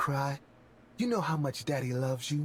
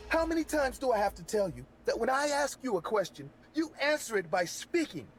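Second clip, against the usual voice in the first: mock screaming; 13 semitones higher than usual. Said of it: "the man began screaming at her".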